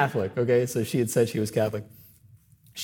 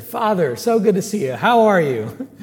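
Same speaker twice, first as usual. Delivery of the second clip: cheery greeting; 7 semitones higher, 6 dB louder, slower than usual. Retold or imitated. Parishioner greeting the priest warmly.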